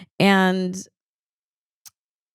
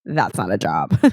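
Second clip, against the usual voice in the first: low, scornful put-down; dismissive deep voice; 11 semitones lower than usual. Retold or imitated. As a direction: drop the voice and scoff. Bose mocking Amanda's job.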